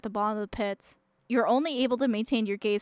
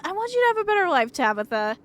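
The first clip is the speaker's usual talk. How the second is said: fast, high earnest plea; heartfelt fast high plea; urgent plea; 8 semitones higher than usual. Real, not acted. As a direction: rush it out, pleading. Earnest plea in game choice.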